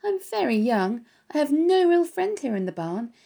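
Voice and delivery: a lonely pig breaking into tears; crying. Acted. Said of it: "And Wilbur was crying again".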